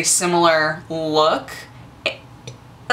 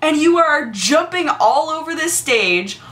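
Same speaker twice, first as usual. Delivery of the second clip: amazed; 11 semitones higher than usual. Retold or imitated. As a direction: marvel at her in disbelief. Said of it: "And I was like".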